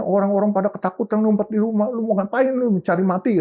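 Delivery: high, fast-starting retold telling-off; imitating angry wife. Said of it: "Nyonya saya marah". (menirukan omelan istri yang marah)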